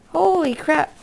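ordinary delivery

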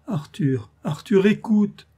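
put-on rising, slowing cry of a desperate girl; desperate plea. Girl begging her lover to listen, desperate rising cry. Giulietta pleads with Arthur.

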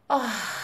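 loud, breath-heavy "ah" of regret; exasperated groan. Real, not acted. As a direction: let out a loud sigh at your own blunder. Groans at her own blunder. (pişmanlıkla yüksek sesli "ah" çekme)

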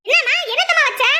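put-on high, loud, nagging older woman; nagging mother-in-law. Mother-in-law scolding daughter-in-law over the burning stove, high and loud. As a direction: scold her about the burning pot.

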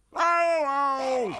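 very high, loud yelps of pain; yelping in pain. A narrator's reading. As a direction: cry out as if bitten. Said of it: "schreeuwt hij". (hoog, luid pijngeschreeuw)

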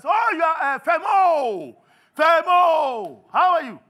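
high, drawn-out shout of welcome; exuberant, very loud acted welcome; boisterous greeting. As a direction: holler the name like greeting an old pal. Boisterous lender greets Femo.

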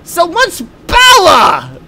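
very slow, high, loud "power"; hammed-up villain roar; dubbed-anime shout. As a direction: drag out "power" as a shouted pow-ah. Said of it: "They say power".

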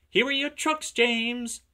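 high-pitched, loud little tank engine; cheeky little engine. A narrator's reading. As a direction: tease in a high, small-engine voice. Little engine teasing James.

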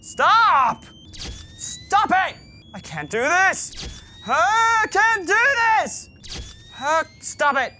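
high frantic scared yelling; panicked screaming. Real, not acted.

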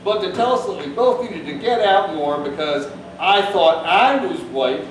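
ordinary talk, nothing marked

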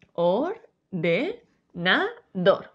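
or, de, na, dor with gaps between; one syllable at a time. Shown as a demonstration. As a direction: break it into four pieces. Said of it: "Si dividimos las sílabas".

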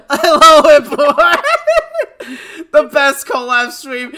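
loud, high, laughing greeting. Real, not acted. Streamer greeting a viewer while cracking up, loud laughter.

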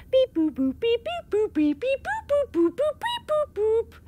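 playful high beep-boop noises; sing-song button beeps; robot beeps. Acted. Pressing the rocket's buttons.

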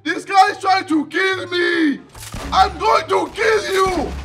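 high, slow, stretched-out yells; alarmed shouting. Real, not acted. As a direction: holler in alarm as the attack hits. Under fire in the game.

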